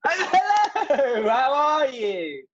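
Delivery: high, laughing, slow hammed-up hello; laughing greeting. Guest returning from the freeze to the hosts, laughing hello. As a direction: greet them through a laugh, drawn out.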